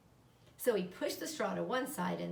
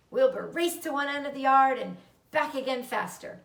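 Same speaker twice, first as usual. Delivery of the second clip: ordinary delivery, nothing marked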